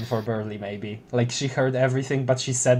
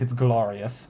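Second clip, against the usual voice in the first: ordinary talk, nothing marked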